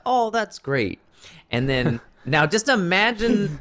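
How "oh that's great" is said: put-on high and chipper, accent barely hinted; a pleased clerk, lightly accented; light Indian accent. A clerk pleased for an old friend, light Indian accent. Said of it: "he's like".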